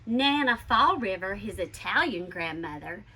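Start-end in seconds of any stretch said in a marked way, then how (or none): none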